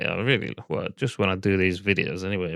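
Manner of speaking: ordinary talk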